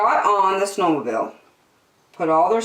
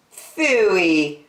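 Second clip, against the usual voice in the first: drawn-out, scoffing "phooey"; dismissive scoff; 3 semitones higher, 4 dB louder than usual. Acted. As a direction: scoff like a cocky kid. Girl scoffs at her father.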